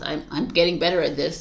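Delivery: ordinary talk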